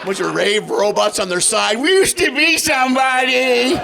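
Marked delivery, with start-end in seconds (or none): 1.8-3.8 put-on sad old robot. Voicing discarded robot stands.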